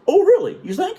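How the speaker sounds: high, loud sarcastic jab; mock him with sarcasm. Chief sarcastic.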